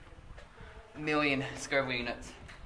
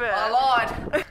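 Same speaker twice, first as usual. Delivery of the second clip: loud, strained through pain; 10 semitones higher, 8 dB louder than usual. Real, not acted. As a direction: force it out through the heat. Mouth on fire from pepper.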